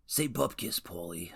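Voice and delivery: badly faked gangster character, tailing off softly; terrible mobster impression. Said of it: "Those are terrible impressions".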